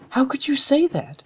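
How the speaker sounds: high, loud outraged question; shocked, angry Twilight. A narrator's reading. Twilight confronts Rainbow, high and outraged. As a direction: recoil in disbelief. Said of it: "She shot an angry glare".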